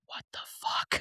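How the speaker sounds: whispered, breathy disbelief; mouthed whisper. Retold or imitated. Boyfriend behind them mutters disbelief, whispered mock. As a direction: whisper it like a stranger judging. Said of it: "the guy who was behind me that was like".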